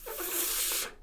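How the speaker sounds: unvoiced hissing f airstream; long f sound. Shown as a demonstration. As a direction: blow a steady f. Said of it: "make a f sound".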